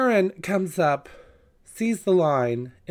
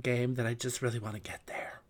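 whisper; 4 semitones lower, 8 dB quieter than usual. Real, not acted. Trailing whisper.

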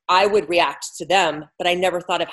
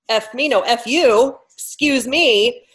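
retold outburst, higher and louder, the excuse me stretched slowly; mock outburst; 6 semitones higher, 5 dB louder, slower than usual. Retelling her reaction to her friend hanging up, loud mock outburst. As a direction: blurt it out, then catch yourself with a slow excuse me. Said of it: "And I'm like".

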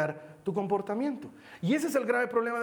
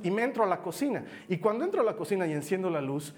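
usual speaking voice

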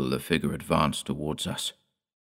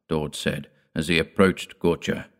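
plain reading voice

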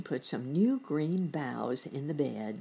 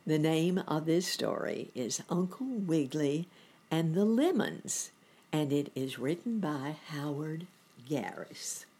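usual speaking voice

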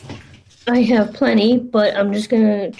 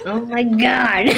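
laughing exasperation; real laughter; 3 dB quieter than usual. Real, not acted. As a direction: gasp it out through laughter. Cracking up at the silly voice.

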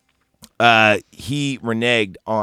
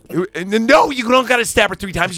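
mock frantic yell; 9 semitones higher, 3 dB louder than usual. Frantic Lance yelling at Vincent.

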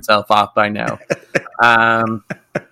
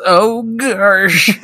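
put-on high startled gasp of a kid; mock shock; 8 semitones higher than usual. Boy finding his hand goes through his brother, startled. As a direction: blurt it, suddenly scared. Kid realises he's a ghost.

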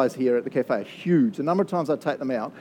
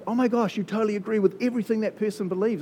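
mock outraged commenter; 8 semitones higher than usual. Social media pile-on mocked.

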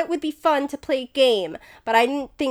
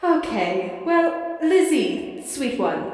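knowing chuckling tone; 5 semitones higher, slower than usual. Acted. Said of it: "lets out this low chuckle and is like".

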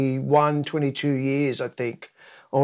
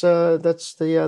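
ordinary talk, nothing marked

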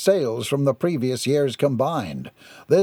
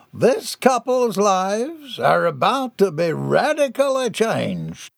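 high, slow, grand announcement; proclaiming captain; 7 semitones higher, slower than usual. A narrator's reading. Captain turning to the mass of people, grand proclamation. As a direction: draw it out for the whole crowd.

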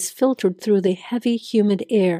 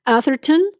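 calling out a name; 6 semitones higher than usual. Acted. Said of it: "call her name again".

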